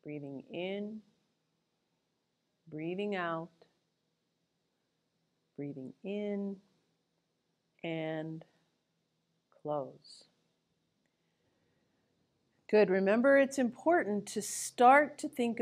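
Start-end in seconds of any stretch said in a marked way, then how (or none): 0.0-10.2 soft, slow breathing cues; guided breathing. Acted. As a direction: ease them into the breath gently.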